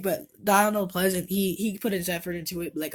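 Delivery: ordinary delivery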